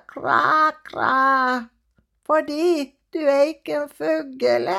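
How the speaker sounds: a lightly voiced crow gently scolding. The crow lectures Elle after landing, lightly voiced.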